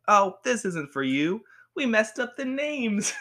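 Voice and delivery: high, slow mock sitcom voice; put-on cheesy sitcom delivery; sitcom reveal. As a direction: announce a silly mix-up, hammed-up. Acted sitcom twist delivered to patient.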